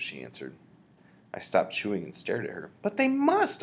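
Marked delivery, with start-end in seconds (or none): 2.8-3.6 high, loud protesting cry; the boy cries out in disbelief; higher, louder child's outcry; cried out. Acted. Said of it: "I cried".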